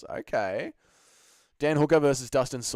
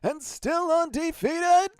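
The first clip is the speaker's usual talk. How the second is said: announcer boast; 5 dB louder, slower than usual. Pretend.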